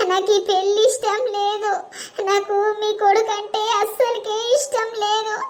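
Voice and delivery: put-on young bride weepily pleading with her grandmother; tearful bride. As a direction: beg granny, rising toward sobs.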